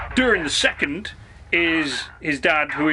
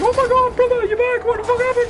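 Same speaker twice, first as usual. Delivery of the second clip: shrill mock falsetto, much higher and louder; mock Galadriel; 4 dB louder, faster than usual. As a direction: squeal it like an overexcited elf sister. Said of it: "she would have been like".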